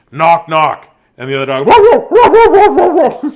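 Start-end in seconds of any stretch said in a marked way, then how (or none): 0.1-1.0 dog voice calling out knock knock loudly. Acted. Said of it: "And he goes". 1.7-3.3 very high, loud barking whoa; dog barking. Acted. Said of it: "They bark".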